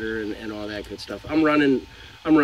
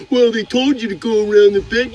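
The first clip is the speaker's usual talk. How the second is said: imitating dock worker; 10 semitones higher, 7 dB louder than usual. Dock worker answering driver.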